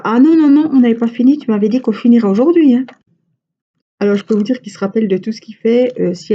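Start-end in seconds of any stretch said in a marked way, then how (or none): 0.0-2.8 high, loud whiny protest; hammed-up child objecting loudly. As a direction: insist like a kid who remembers everything. Child protesting a finished game.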